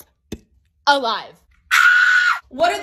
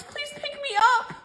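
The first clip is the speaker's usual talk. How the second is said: whiny plea; 9 semitones higher, 6 dB quieter than usual. Acted. Whimpering kid plea.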